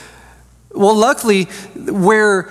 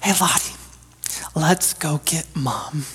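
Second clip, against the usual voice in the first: conspiratorial whisper; 3 semitones lower, 8 dB quieter than usual. Retold or imitated. Dad whispering to his daughter about sneaking up on mom, hushed. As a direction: lean in and whisper the plan. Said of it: "I'll lean down, and I'll be like".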